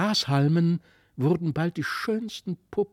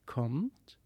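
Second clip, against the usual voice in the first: soft call; 5 dB quieter than usual. A narrator's reading.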